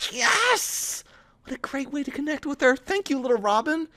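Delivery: thrilled inner cheer. Acted. Narrator cheering to herself after Thea agrees, high and bright. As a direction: burst out with delight.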